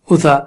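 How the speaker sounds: اوسا said short, the n lost; short اوسا with no hum before سا; no ghunna. Shown as a demonstration. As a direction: drop the nasal hold in اوسا. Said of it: "نہیں کھینچیں گے تو ہو سکتا ہے دب ہی جائے".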